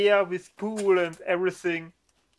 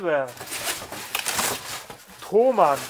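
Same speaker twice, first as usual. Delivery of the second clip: ordinary delivery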